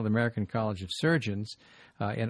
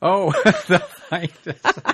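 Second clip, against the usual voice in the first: real laughter echoing the joke; laughing repeat; 6 semitones higher, 10 dB louder, slower than usual. Real, not acted. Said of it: "Oh, that's great".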